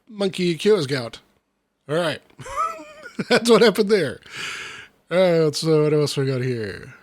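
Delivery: ordinary delivery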